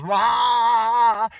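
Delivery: a sudden loud high "Rah!" of craziness; mock rage roar. Voicing the craziness as a roar. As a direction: blurt a wild roar.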